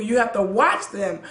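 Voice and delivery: ordinary delivery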